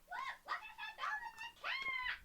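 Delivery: put-on high, slow child's voice; excited little girl. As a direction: squeal it like an excited kid. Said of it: "and she's like".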